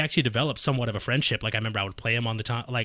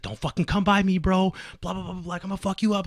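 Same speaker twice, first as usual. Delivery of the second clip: high, raised angry threat; 7 semitones higher than usual. Retold or imitated. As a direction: bark it like an angry drunk. Retold threat from angry Sanford.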